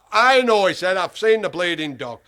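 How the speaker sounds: loud outburst about the dog; loud accusation. Retold or imitated. Keeper shouts that he saw the dog, loudly.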